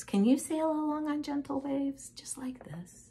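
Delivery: put-on soft, airy whisper to children; softly hushed, like gentle waves; hushed gentle storytelling. Shown as a demonstration. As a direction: invite them gently, almost whispering.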